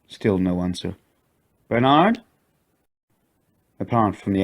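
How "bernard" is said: higher questioning call. A narrator's reading. Katie calls for Bernard, higher uneasy call. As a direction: call into the dark tunnel. Calling into tunnel again.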